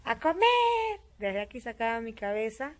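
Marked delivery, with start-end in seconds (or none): 0.0-0.4 a high, loud call to the table; retold shout of dinner's ready; calling everyone to eat. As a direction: call the family in from the kitchen. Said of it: "me acuerdo que yo decía".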